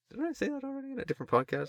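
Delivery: quick, very high squeak; high silly voice. Pretend. Joking after talk of Mario's voice, high squeak. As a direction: rattle it off way up high.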